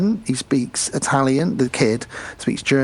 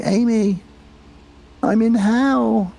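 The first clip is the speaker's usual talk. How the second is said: much higher, slow eerie call; ghostly child voice; 10 semitones higher, slower than usual. Retold or imitated. Ghost brother voice retold.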